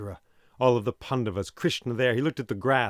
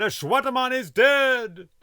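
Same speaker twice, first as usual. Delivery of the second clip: much higher, louder triumphant yell; full shout; 6 dB louder than usual. Acted. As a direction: bellow the news across the camp. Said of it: "began shouting".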